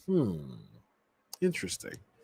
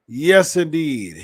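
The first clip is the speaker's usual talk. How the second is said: slow, loud drawn-out yes; Vince McMahon impression; 4 semitones higher, 6 dB louder, much slower than usual. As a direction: stretch the yes out slowly and loudly. Imitating Vince McMahon catchphrase.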